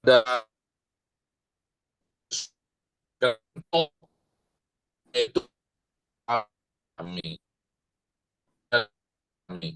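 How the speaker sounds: choppy, breaking audio; bad connection. Real, not acted. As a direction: voice cutting in and out. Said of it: "Muse putus-putus".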